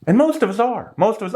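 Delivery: normal talking voice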